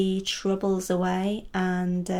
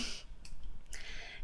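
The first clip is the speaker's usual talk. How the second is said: breathy laugh; 14 dB quieter than usual. Real, not acted.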